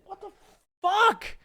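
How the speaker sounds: very high, loud stunned dog squeal; baffled dog voice. Retold or imitated. Dog watching the deer in disbelief, high squeal. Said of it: "just like".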